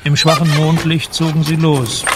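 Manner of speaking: usual speaking voice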